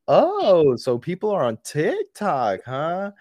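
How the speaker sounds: high, loud amazed exclaim; surprised. Retold or imitated. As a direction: gasp it out in surprise. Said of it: "and I'm like".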